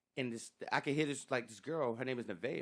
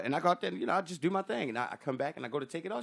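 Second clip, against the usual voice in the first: ordinary talk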